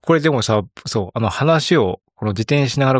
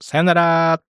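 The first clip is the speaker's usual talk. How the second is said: higher, sustained goodbye; sign-off farewell; 6 semitones higher, much slower than usual. Acted. Co-host signs off to the listeners, drawn-out farewell. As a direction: hold the last vowel on a raised pitch. Signing off after the closing script.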